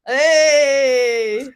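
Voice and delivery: loud, high, drawn-out cheer; excited greeting. Real, not acted. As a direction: hail a late arrival with delight. Greeting the late guest arriving.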